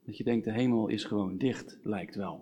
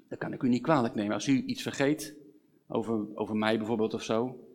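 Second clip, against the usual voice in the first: ordinary talk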